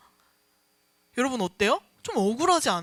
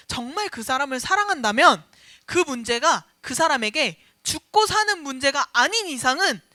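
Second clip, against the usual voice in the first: normal talking voice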